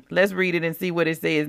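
usual speaking voice

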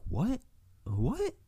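high squeaky disbelief; shocked what. Retold or imitated. Friend's shocked reply.

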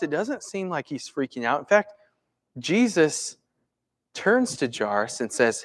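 ordinary talk, nothing marked

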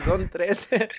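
put-on kid's line with a laugh, stretched trece; imitating the kid. Imitating the boy from the video, laughing. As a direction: quote the boy, laughing through it. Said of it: "el morrito que dice".

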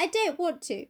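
high-pitched mock petulance; hissy-fit Dorian. Dorian refusing to pray with the painter, hissy fit. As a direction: refuse like a sulky child. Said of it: "like, a hissy fit with Basil".

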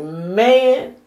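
high, drawn-out exasperated exclamation. Real, not acted. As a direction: stretch it out in fed-up disbelief. Reacting to the partner's endless demands.

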